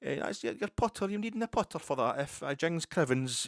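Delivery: hammed-up high cinema Scots accent, loud; mock Hollywood Scottish caddie voice. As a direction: camp it up as a movie Scotsman for American golfers. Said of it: "stereotypical cinema Scot".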